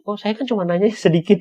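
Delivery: high, taken-aback reply with a laugh; confused. Retold or imitated. Questioner confused by the outburst, bewildered. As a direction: sound baffled, laughing it off. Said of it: "yang bertanya bingung".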